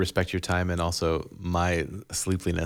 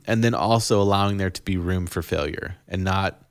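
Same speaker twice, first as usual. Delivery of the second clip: normal talking voice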